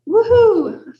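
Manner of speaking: high, loud whoop; celebratory cheer. Real, not acted. Host rallying the audience for creative writing, celebratory cheer. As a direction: let out a quick rising-falling cheer.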